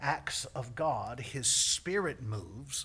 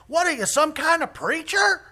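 high, loud incredulous shout; imitating a rescuer's full yell; yelling paramedic; 13 dB louder than usual. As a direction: holler the question while approaching. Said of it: "he's still yelling as he comes".

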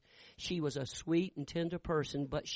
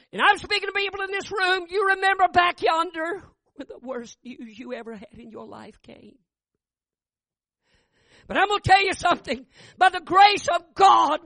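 high, loud revival preaching; fiery preaching; 13 semitones higher, 12 dB louder than usual. Acted. Preacher thunders the testimony at his congregation. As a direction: preach it home to the crowd.

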